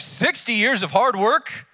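a cow aghast at the workload; indignant cow. Acted. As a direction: protest like a put-upon cow. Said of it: "the cow's like".

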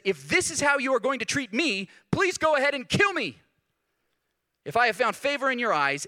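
high, loud desperate plea; Moses pleading. Retold or imitated. Preacher quoting Moses to God, loud high pleading. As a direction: beg God to end it, voice climbing. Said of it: "He says".

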